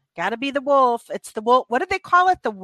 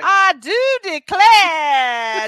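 slow, drawn-out Southern belle drawl, pitched higher and louder; 6 semitones higher, 6 dB louder, much slower than usual. Retold or imitated. Friend mocks the actress's overdone Southern belle line in a slow drawl. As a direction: stretch it like an overacting Southern belle. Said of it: "with that southern drawl".

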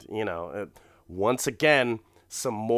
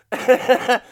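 loud high burst of laughter; big laugh; 13 semitones higher, 10 dB louder than usual. Real, not acted. Cracking up at dog steak.

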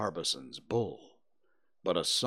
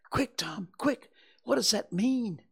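high-pitched, louder, urgent demand; panicked urgency; 5 dB louder than usual. Acted. Huck begs Tom what the omen means, panicked. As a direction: press for the answer in a panic.

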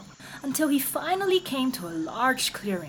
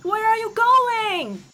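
high, loud cry after her son; mother crying out; 13 semitones higher, 7 dB louder, slower than usual. Acted. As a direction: call him back, high and loud. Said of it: "his mother cried".

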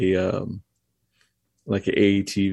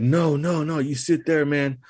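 higher, firm refusal, retold; insistent stranger; 7 semitones higher than usual. Helper refusing to let the driver walk, lightly voiced insistence. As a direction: wave him back down, a hint of urgency.

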